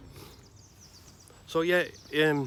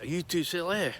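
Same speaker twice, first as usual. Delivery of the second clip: lightly imitated Scottish accent, pitched up; a friendly Scottish footballer, higher, surprised; 7 semitones higher than usual. Footballer finding two waiting kids, mild Scottish accent. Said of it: "Scotch accent, ... Like that".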